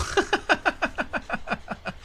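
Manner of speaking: high, quick staccato giggle; light rapid laugh trailing down; amused chuckle. Real, not acted. Streamer reading a chat message, chuckling in amusement.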